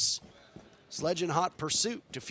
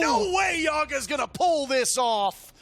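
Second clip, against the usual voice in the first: excited shout; 8 semitones higher, 4 dB louder, slower than usual. Real, not acted. Clutch moment in live cast.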